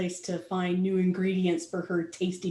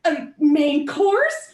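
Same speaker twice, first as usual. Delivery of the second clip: much higher scolding; much higher, indignant; indignant pony; 14 semitones higher, 6 dB louder than usual. Acted. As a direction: scold the others for seasoning him. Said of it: "she says".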